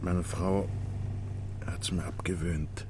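soft unvoiced whisper; whispered aside. Husband whispering about his wife to the inspector. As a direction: breathe it out under the breath.

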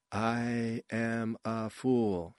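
very slow, drawn-out stiff recital; wooden line reading. A narrator's reading. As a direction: recite each word slowly, with no feeling. Wooden actress repeats her line.

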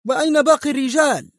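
fast, high-pitched nervy question; edgy, urgent demand; agitated. A narrator's reading. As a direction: fire the question out, high and quick. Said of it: "ثم سأله في عصبية".

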